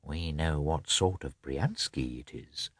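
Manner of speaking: slow, soft, sly aside; knowing pout. A narrator's reading. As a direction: say it slowly and quietly with a pout. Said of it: "pouted as if to say".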